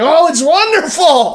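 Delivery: high, loud victory yells; ecstatic shouting. Real, not acted. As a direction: scream it like your team just won. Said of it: "a little excited".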